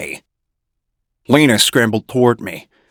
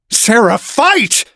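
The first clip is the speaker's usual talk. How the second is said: urgent shout; 13 semitones higher, slower than usual. A narrator's reading. Urging Sarah.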